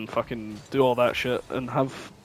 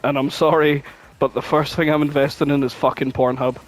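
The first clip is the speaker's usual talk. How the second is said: ordinary talk, nothing marked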